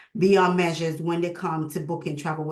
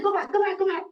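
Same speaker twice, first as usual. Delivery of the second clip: much higher, a mock-amazed go back repeated; excited disbelief; 13 semitones higher, much faster than usual. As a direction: replay a mother's thrilled double take. Mother amazed by son's news.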